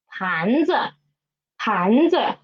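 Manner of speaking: teacher's model 盘子, 子 stepping down after rising 盘. Shown as a demonstration. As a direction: lift 盘, then drop a light 子. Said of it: "Read after me".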